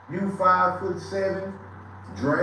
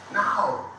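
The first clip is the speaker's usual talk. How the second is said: ordinary talk, nothing marked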